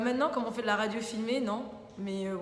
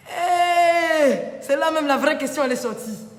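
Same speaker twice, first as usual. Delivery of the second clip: long high shout, easing as it goes on; excited outcry; 7 semitones higher, 12 dB louder, slower than usual. Real, not acted. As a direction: whoop loudly at the juicy question. Reacts to question about her love life. (cri excité, très fort et aigu)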